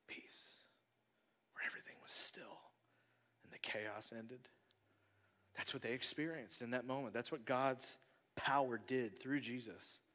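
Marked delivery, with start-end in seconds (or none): 0.1-4.5 breathy whisper of longing; soft wishful whisper. Real, not acted. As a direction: whisper it like a wish. Longing for peace.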